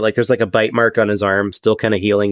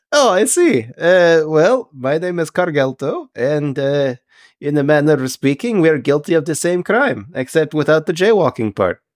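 higher-pitched, stilted fish-man; a formal sea creature introducing himself haltingly; courteous Triton, pitched up; Triton, politely sociable; 4 semitones higher than usual. Acted. Game master plays the Triton.

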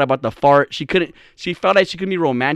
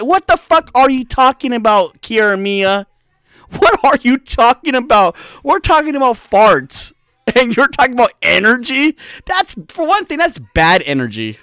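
higher, punchy incredulous rant; mock exasperation; 6 semitones higher, 3 dB louder than usual. Comic rebuking Kiera Mia for energy talk, exasperated. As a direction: challenge her nonsense in comic disbelief. Mock outburst answering the impression.

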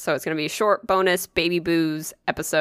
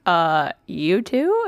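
drawn-out, hesitant question; baffled reply; much slower than usual. Retold or imitated. Customer replaying the odd exchange, baffled. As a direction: imitate a slow, unsure answer rising at the end. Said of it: "I was like".

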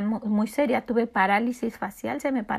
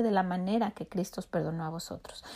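hushed whisper of the verse; whispered scripture; 3 semitones lower, 9 dB quieter, faster than usual. Acted. Whispered scripture quote continued.

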